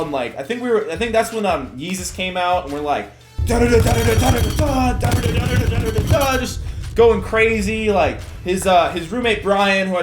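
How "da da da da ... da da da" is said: loud, higher rapid-fire da-da-da; wild chanted da-da-da. Retold or imitated. Storyteller imitating drunken banging on the wall, wild and loud. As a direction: hammer out frenzied nonsense syllables.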